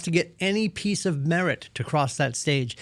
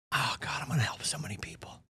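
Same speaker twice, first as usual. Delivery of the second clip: hushed, rushed starry-eyed vow, much softer; mock breathless whisper; 2 semitones lower, 11 dB quieter, faster than usual. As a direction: whisper it fast like a giddy rookie. Mocking new agents' eager vow.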